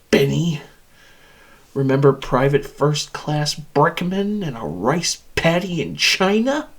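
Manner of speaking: ordinary talk, nothing marked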